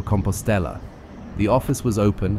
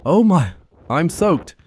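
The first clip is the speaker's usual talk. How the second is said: higher, louder, slow dismayed groan; 9 semitones higher, 5 dB louder, slower than usual. Real, not acted. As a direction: groan it out, drenched. Soaked after crossing.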